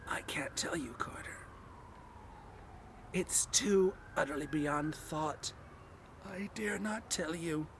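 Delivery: hushed, despairing whisper; hoarse with fear. A narrator's reading. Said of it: "still hoarse with fear".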